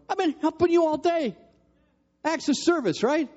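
high, exasperated inner complaint; inner grumble. Retold or imitated. Husband grumbling to himself about helping all day, exasperated. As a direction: vent the silent frustration. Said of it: "in my brain, I'm going".

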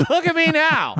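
squeaky high, showing off; high mock boast. Real, not acted. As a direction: pitch it way up, boasting. Repeated, much higher.

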